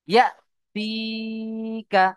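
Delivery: ইয়াতিকা chanted slowly, held long on a high tone, with a short unstretched কা; ইয়াতিকা drawn out like a recitation, keeping কা short; Quranic recitation. Shown as a demonstration. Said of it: "এইভাবে পড়তে হবে".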